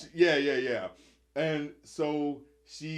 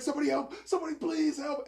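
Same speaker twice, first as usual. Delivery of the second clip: imitated screaming for help; 12 semitones higher than usual. Cuffed man dragged toward the house, mock screaming for help. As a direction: cry out desperately, holding the volume back. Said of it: "he's screaming for dear life".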